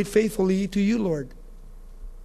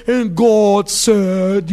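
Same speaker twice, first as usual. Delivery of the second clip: loud, very drawn-out mock pronouncement; hammed-up solemn declaiming; pompous orator; 5 dB louder, much slower than usual. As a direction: stretch each word like a self-important preacher. Mimics a pompous learned reader.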